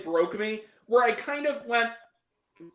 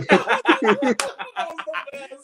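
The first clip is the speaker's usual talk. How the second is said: laughing through the words; high voice breaking up with laughter; real laughter; 7 semitones higher, 6 dB louder, much slower than usual. Real, not acted. Cracking up at a viewer's comment.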